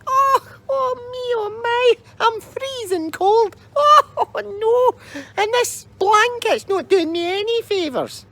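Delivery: put-on very high, whimpering old crone; wailing old lady.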